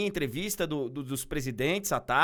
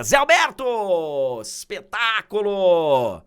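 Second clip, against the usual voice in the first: booming showman introduction, name drawn out slow; ringmaster; 8 dB louder, slower than usual. Acted. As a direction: announce the guest like a ringmaster. Grand circus-style guest introduction.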